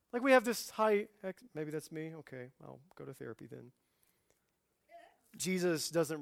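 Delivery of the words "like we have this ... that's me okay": usual speaking voice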